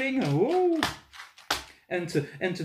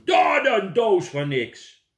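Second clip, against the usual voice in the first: loud slow scornful yell; angry shout; 4 semitones higher, 9 dB louder, slower than usual. Acted. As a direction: fling the words out in disgust. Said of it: "de melkboer schreeuwen". (luid en minachtend schreeuwen)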